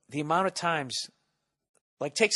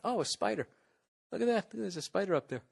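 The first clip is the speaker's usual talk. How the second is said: higher and softer, caught by a spider; hushed, higher voice noticing something; suddenly distracted; 5 semitones higher, 6 dB quieter than usual. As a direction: drift off mid-thought to point at a spider. Distracted by a spider mid-talk.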